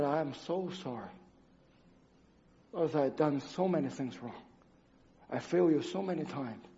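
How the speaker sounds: soft, low confession to God; kneeling by the bed, a hushed sorry; hushed prayer. Retold or imitated. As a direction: pray it quietly, ashamed. Tearful prayer beside the bed.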